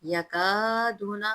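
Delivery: slow chanted verse with long vowels; tajweed recitation. Shown as a demonstration. Teacher reciting the verse for students, slow melodic chant. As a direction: chant each word slowly and melodiously.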